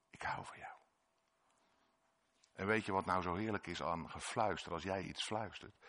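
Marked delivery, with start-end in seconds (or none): 0.1-0.8 soft unvoiced whisper; hushed loving whisper. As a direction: breathe it close to the ear. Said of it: "En dat zachte gefluister".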